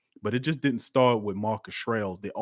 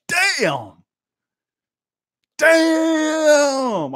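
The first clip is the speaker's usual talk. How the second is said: loud, much higher shocked shout; shocked exclamation; 10 dB louder than usual. Retold or imitated. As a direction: yell it in disbelief. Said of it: "I was like".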